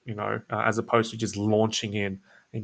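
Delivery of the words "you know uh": ordinary talk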